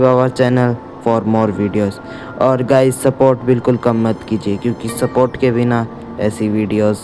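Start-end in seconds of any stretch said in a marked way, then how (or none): none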